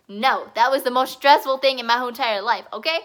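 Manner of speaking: loud, punchy exasperation; loud, insistent complaint; emphatic venting. Real, not acted. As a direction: vent it loudly, hitting each word hard. Venting about stressful trip.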